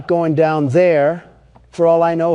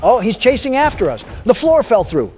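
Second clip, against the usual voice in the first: high alarmed, raised voice; being chased; 6 semitones higher, 4 dB louder than usual. Real, not acted. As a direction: blurt it out while fleeing. Monster chasing him.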